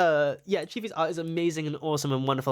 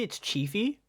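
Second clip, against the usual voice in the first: ordinary delivery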